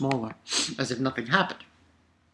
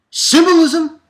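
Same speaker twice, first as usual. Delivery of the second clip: high, loud, drawn-out proclamation of the word; emphatic declaration; 13 semitones higher, 11 dB louder than usual. Real, not acted. To viewers, proclaiming his favourite word, loud and high. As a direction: belt the word out like a slogan.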